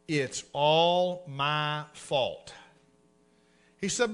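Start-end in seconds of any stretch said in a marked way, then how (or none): 0.1-2.5 "It's all my fault" stretched very slowly and low; slow drawn-out confession. Shown as a demonstration. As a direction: spell it out word by word, slowly. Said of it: "Get this out in English".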